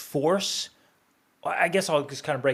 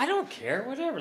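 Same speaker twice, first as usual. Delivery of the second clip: mock dismissive; 12 semitones higher than usual. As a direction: brush it off in a high, laughing replay. Replaying own side of the quarrel.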